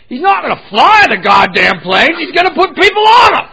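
loud, high yell through the wall; boss yelling. Retold or imitated. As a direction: bellow it like an angry boss. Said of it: "I hear through the wall him yell at her".

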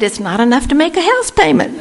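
high, laughing as she says it. Retold or imitated. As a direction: giggle through it. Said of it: "I started laughing".

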